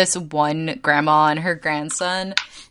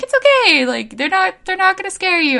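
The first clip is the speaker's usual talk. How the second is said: put-on much higher, soothing voice for a scared kid; reassuring a child; 14 semitones higher, 4 dB louder than usual. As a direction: coax a nervous little girl, sweet and high. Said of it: "trying to be like".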